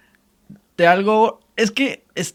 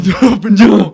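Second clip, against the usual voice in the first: louder echo of the ghostly voice; demonic double voice; 3 semitones higher, 10 dB louder, slower than usual. Acted. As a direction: join in as the voice from beyond. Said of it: "una voz de ultratumba".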